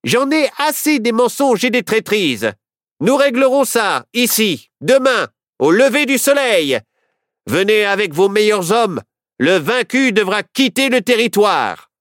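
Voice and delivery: high, loud sheriff's shout. A narrator's reading. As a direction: shout to cover the noise. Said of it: "Le shérif cria alors".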